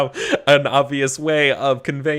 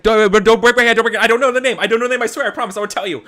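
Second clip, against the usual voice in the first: high, loud, frantic captive's plea; panicked begging; 12 semitones higher, 8 dB louder, faster than usual. Acted. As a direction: beg desperately for your hand, voice shooting up. Captive begging not to be hurt.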